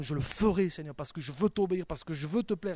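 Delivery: impassioned preacher's peak, rising sharply at the end; fervent prayer climax. Acted. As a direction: pledge obedience with mounting fervour. Acted prayer builds to climax.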